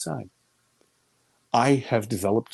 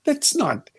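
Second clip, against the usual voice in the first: dawning alarm; 13 semitones higher than usual. Retold or imitated. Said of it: "and then I went".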